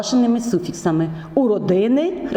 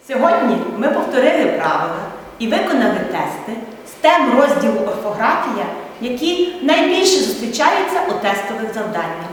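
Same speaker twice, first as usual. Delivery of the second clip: upbeat closing address; 3 semitones higher than usual. Acted. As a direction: send the students off with encouragement. Teacher's closing summary to students.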